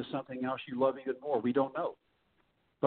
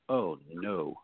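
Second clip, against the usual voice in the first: lower, deadpan "Oh, no" of sinking dread; imitating his own inner groan as the scout shakes the radar gun; mock dismay; 7 semitones lower than usual. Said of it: "You're like".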